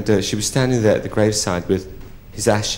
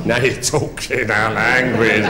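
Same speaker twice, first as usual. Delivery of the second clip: relishing innuendo; slower than usual. Acted. Prostitute's lewd reply to request.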